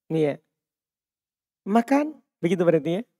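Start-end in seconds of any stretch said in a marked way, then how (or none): 1.6-2.2 Makan with a rising end like a question; Selayar-style rising tune on Makan. Shown as a demonstration. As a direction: say Makan as a command but rising like you are asking. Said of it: "Tapi seperti bertanya".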